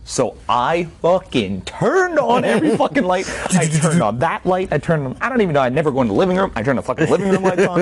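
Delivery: high, rushing, worked-up storytelling; frantic retelling. Retold or imitated. Telling how every light went on, frantic. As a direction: spill it out in a reenacted panic.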